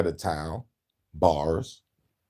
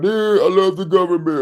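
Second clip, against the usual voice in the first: higher, louder dopey mock voice; mock zombie fan; 10 semitones higher, 6 dB louder than usual. Host mocking people who love the government, in a dopey voice. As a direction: ham up a clueless government fan.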